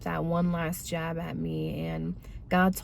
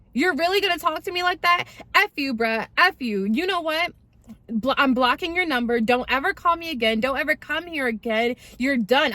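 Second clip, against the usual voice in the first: indignant, higher-pitched telling-off; retold angry tirade; 6 semitones higher than usual. Girlfriend cutting off boyfriend who insulted her, angry higher-pitched tirade. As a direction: re-enact the blow-up, voice raised in indignation. Acted breakup quarrel with boyfriend.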